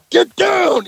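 high, loud barked order from an angry cop; cop screaming. Retold or imitated. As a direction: yell it like a fired-up little cop. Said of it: "he's screaming".

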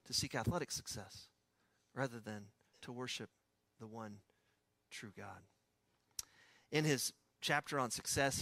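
ordinary delivery

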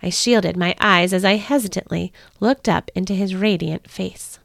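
usual speaking voice

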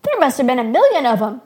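high, loud, amazed shepherd; excited interruption. A narrator's reading. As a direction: burst in loudly with the marvel. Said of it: "Blue Shell interrupted".

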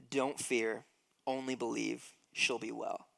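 Jesus comforting Jairus, softly; gentle reassurance. Retold or imitated. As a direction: soothe a grieving father.

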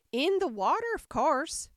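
high, loud dismissive answer; impatient Sheep. A narrator's reading. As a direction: snap back as the testy Sheep. Narrator voices the impatient Sheep.

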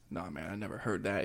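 lightly voiced tough guy, low and soft; ripped big dude. Imitating the ripped guy answering Jeff in the store, low and understated. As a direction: drop low and quiet for a mild big-dude impression. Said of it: "and he's ripped".

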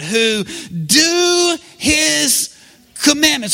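"do" stretched very long, high and loud; out loud, stressing DO. Shown as a demonstration. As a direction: belt out "do" and hold it before going on. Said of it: "Everybody say it out loud".

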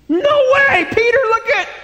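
loud, very high-pitched cry of disbelief; amazed shouting. Acted. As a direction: shout like an astonished onlooker in the boat. Disciples amazed, watching Peter walk.

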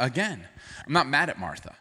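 usual speaking voice

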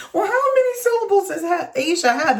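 high-pitched, exasperated question; incredulous comeback. Retold or imitated. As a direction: throw it back in high disbelief. Incredulous comeback about syllables.

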